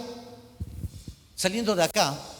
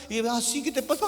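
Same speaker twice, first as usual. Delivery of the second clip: ordinary talk, nothing marked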